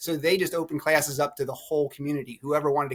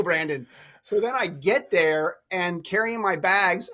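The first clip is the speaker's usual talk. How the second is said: normal talking voice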